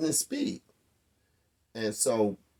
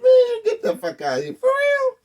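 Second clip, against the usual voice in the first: much higher, louder laughing shock; laughing disbelief; 10 dB louder than usual. Real, not acted. Teller hears he was nearly killed, laughing and loud in disbelief.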